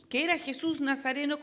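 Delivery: normal talking voice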